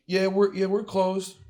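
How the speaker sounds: mimicking the worker. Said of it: "they're like".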